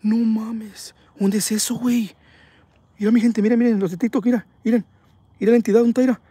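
high-pitched, urgent disbelief; astonished excitement. Real, not acted. Streamer pointing out the entity to TikTok viewers, astonished. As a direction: keep the pitch up, amazed and insistent.

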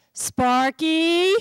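loud, high shout of the name; angry shout. Acted. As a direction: yell it at the mess. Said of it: "Josh shouts".

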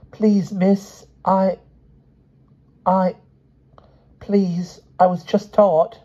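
high, halting schoolboy with long gaps; falter, restart, then blurt it out; flustered child dodging the question; nervous stammer. A narrator's reading. Boy stammers answer to teacher.

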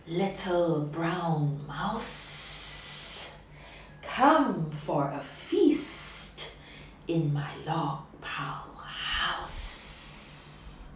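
slow, low, whispery snake; hissing snake. Acted. Snake luring the mouse, slow and whispery.

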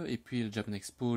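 ordinary talk, nothing marked

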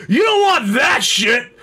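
slow, high shouted objection; older drunk man. Retold or imitated. Old man scolding a shopper over snacks, slow high shout. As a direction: holler it like a meddling old drunk. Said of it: "a man in his, like, sixties or seventies".

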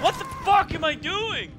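high-pitched cry of self-frustration; exasperated outburst. Real, not acted. Player berating their own play, exasperated outburst. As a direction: wail it in disbelief at yourself.

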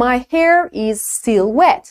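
"still" with a clean S and "wet" with rounded W; slow clear model sentence; correct model. Shown as a demonstration. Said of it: "اینجوری باید حرف بزنی". (جملهٔ درست، شمرده)

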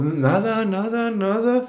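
slow, soft, mumbled "nada" in an odd voice; drunken denial. Retold or imitated. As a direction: play dumb and drunk, drawn out. (negación lenta y suave de borracho)

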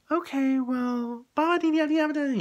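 high, slow mock singsong; hammed-up babbling; dismissive singsong. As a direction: brush it off in a silly sing-song. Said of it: "And I'm like".

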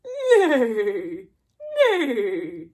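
loud whinnying neigh; horse neighing. Shown as a demonstration. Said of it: "What does the horse say?".

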